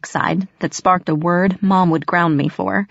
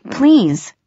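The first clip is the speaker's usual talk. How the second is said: high, pleading cry; begging; 8 semitones higher, 4 dB louder than usual. Acted. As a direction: plead desperately. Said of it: "I begged".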